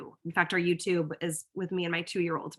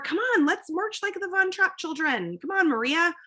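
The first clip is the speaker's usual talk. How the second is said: high, sing-song call to march; rally the kids like a game leader; playful rallying; 9 semitones higher than usual. Retold or imitated.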